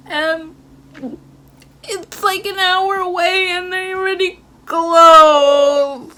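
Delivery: hammed-up bawling on the last words; retold crying. Daughter telling dad the place already closed, wailing theatrically. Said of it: "I'm crying so hard".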